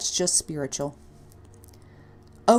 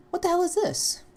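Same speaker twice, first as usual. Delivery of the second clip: startled; 12 semitones higher than usual. Acted. Finds odd object in scarf.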